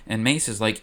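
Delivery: usual speaking voice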